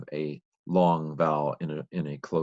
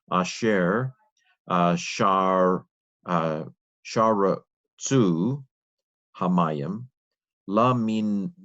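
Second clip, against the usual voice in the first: sounding out Hebrew word by word, slowly; slow careful reading; 3 dB louder, much slower than usual. Shown as a demonstration. Learner reading Genesis aloud, slow and careful. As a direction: read each word deliberately, pausing between.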